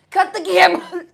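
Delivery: loud laughing cry mid cough; half-choked loud call; laughing loud demand, coughing; laughing, coughing shout. Real, not acted.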